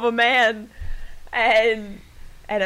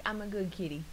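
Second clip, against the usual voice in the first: soft innocent cat; 11 dB quieter, faster than usual. Acted. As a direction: say it small and quiet, playing a kitty. Voicing the lazy cat's thoughts.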